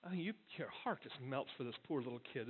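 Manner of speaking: ordinary delivery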